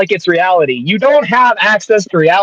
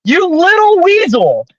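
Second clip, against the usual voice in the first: angry; 6 semitones higher than usual. Real, not acted. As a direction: spit out the insult in rage. Heated insult in debate.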